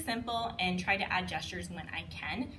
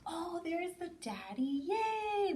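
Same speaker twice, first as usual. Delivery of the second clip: cheerful discovery; 10 semitones higher, much slower than usual. Shown as a demonstration. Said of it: "at the end".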